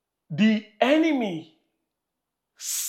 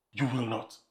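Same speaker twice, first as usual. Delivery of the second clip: low, softer repeat of the command; quiet echo; 8 semitones lower, 7 dB quieter than usual. Acted. Pastor repeating the command to the congregation, low and soft. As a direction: drop it low and firm.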